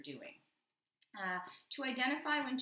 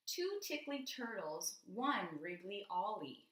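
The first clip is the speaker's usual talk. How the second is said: reading aloud; 2 semitones higher, slower than usual. Acted. Reads the line aloud.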